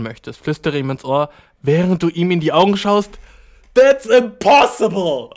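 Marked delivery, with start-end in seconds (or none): 3.7-5.3 higher, louder, drawn-out protest; loud disbelief. Real, not acted. Streamer objecting to whisper-and-eye-contact advice, loud disbelief. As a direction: exclaim it slowly in disbelief.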